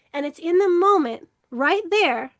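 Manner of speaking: usual speaking voice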